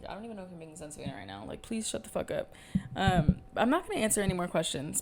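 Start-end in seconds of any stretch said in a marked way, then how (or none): 0.1-2.5 very soft, half-muttered self-talk; muttering to yourself mid-podcast; inner voice. Retold or imitated. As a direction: say it like a thought you can barely hear. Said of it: "I'm talking to myself in my head".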